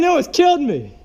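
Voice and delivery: shaken exclaim. Real, not acted. After near crash.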